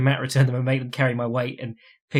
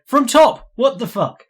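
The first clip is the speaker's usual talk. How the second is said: higher, louder, stunned disbelief; like you can't believe what you saw; disbelieving outburst; 10 semitones higher, 6 dB louder, slower than usual. Real, not acted. Disbelief at the submission.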